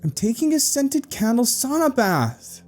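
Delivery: high-pitched sister calling through the door; high, indignant call; annoyed yell. A narrator's reading. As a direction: holler it irritably from the tub. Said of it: "voice suddenly yelled from inside the bathroom".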